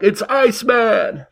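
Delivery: big slow arena-style self-introduction; slow hype announcement. Retold or imitated. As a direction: announce yourself like a wrestler. Announcing himself onstage.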